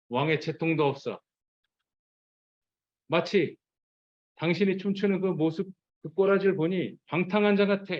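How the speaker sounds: climbing sneer of contempt; sarcastic. Retold or imitated. Michal mocking David's dancing, sarcastic. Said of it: "이렇게 지금 비꼬아서 얘기하는 거예요".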